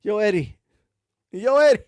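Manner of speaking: ordinary delivery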